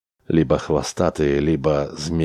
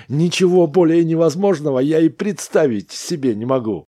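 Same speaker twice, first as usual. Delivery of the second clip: high, incredulous protest; scoffing disbelief; 12 semitones higher, 4 dB louder than usual. A narrator's reading. Smith rejects Chauns's plant theory, scoffing. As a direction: pitch it right up, dismissing the idea.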